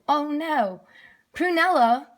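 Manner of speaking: plain reading voice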